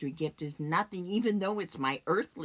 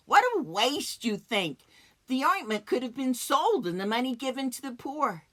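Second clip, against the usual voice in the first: louder, higher outcry at waste; disapproving critic; 6 semitones higher, 6 dB louder than usual. Acted. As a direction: scoff aloud at the wastefulness. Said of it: "secretly agreeing with Judas".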